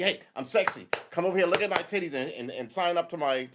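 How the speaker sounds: high, showy self-promoting voice; high, hammed-up influencer voice; mock attention seeker. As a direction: parody someone begging for attention. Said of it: "You're just browbeat".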